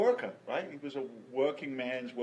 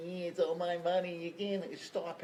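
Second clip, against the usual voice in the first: greedy voice; 7 semitones higher than usual. Pretend. Greedy person voiced in talk.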